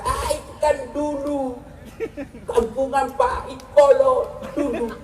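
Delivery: slow, lazy mocking drawl of a bratty kid; a hint of a sulky teenager; dismissive teen. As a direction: brush off the father's advice, slowly and lazily. Rude teen dismissing father.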